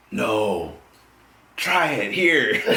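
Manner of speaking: lightly voiced girl. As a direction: lightly imitate a woman urging him on. Voices the girl urging him.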